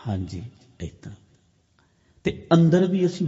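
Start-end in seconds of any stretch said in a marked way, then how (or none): 0.1-1.1 very soft and low; quiet inner voice. Shown as a demonstration. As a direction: drop to a hushed, low demonstration. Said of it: "ਹੌਲੀ ਗੱਲ ਕਰੋ".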